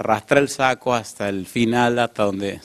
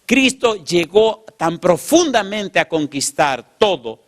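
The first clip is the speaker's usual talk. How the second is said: fervent preaching; 7 semitones higher than usual. Real, not acted. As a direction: build to a passionate climax. Preacher rises to climax. (predicación fervorosa)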